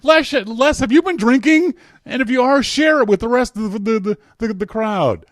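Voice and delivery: loud, high-pitched teasing rant; mock scolding. Real, not acted. Host asks Les if he's been drinking, mock scolding. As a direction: pitch it up high and holler, easing off at the end.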